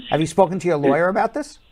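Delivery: usual speaking voice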